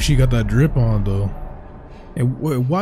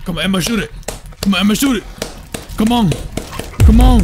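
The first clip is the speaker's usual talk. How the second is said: higher, insistent urging; urgent urging; 5 semitones higher, faster than usual. Real, not acted. Player urges Emma to shoot, pressing and urgent. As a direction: egg her on urgently.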